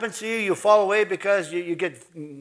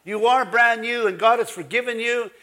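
loud, raised-pitch sermon proclamation; emphatic revival-style preaching; full-force preaching; 4 semitones higher, 5 dB louder than usual. Real, not acted. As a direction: preach it out to rouse the congregation.

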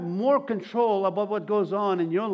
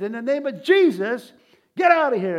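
loud, raised-pitch retold command; frustrated yell; 5 semitones higher, 7 dB louder than usual. Man ordering the devil out, frustrated yelling. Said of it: "he yelled at the devil in frustration".

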